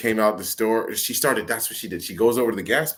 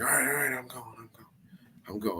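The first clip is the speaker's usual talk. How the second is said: cowering woman; 4 semitones higher, 9 dB quieter than usual. Retold or imitated. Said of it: "And she's like".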